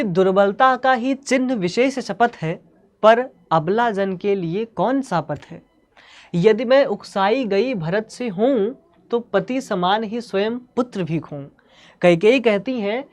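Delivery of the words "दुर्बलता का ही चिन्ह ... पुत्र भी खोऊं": poetry recitation. Acted. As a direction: recite the verse deliberately, drawing out each phrase. Recites the poem lines.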